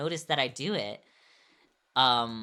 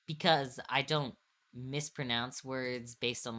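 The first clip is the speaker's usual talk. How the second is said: ordinary talk, nothing marked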